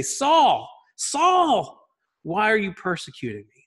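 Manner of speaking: high, loud, ringing call of the name; booming voice from heaven. Acted. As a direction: call down from the sky with authority. Voice from heaven calling Saul.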